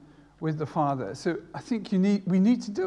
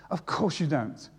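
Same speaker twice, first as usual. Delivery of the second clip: usual speaking voice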